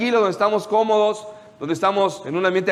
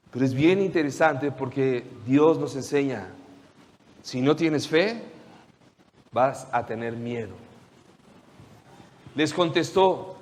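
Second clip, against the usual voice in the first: ordinary talk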